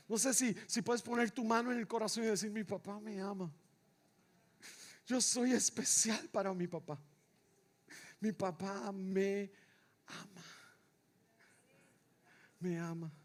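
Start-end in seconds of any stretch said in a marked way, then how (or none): 2.5-13.1 low, soft, spaced-out affirmation; hushed devotional preaching. Real, not acted. Preacher giving congregation words to say, hushed and low. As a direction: let each phrase sink in quietly.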